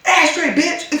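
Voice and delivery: high, loud mock-brazen demand; rude husband. Husband refusing to leave, a high loud contemptuous demand. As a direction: toss it out with lazy contempt. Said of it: "He was like".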